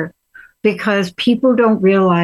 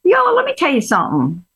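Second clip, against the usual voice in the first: bad Southern accent; 9 semitones higher than usual. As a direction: put on a hammy Southern accent, imitating mom. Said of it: "my bad Southern accent".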